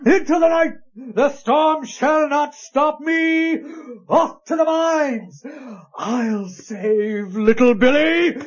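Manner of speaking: high, ringing melodrama cry; hammed-up heroic outburst into the storm; heroic rallying cry. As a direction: fling it out like a stage hero charging off. Merchant rushes to save his heir.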